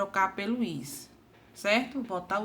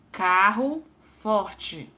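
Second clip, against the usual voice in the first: slow, deliberate; 4 dB louder, much slower than usual. Shown as a demonstration. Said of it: "Observe aqui a palavra carro forte".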